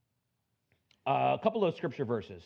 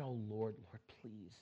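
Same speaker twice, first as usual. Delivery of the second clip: breathy, hushed desperate prayer, much softer; whispered plea; 8 dB quieter than usual. Retold or imitated. Son praying beside his mother's sickbed, whispered pleading. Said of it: "And he cried out to the Lord".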